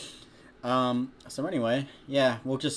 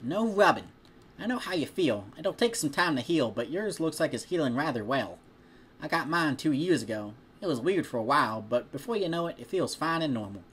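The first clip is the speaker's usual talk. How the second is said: put-on higher, louder farm-girl voice, scolding then kind; friendly farm girl; 4 semitones higher, 3 dB louder than usual. Farm girl reassuring a friend about a healing tattoo, higher friendly voice. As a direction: tell him off, then comfort. Applejack speaking in story.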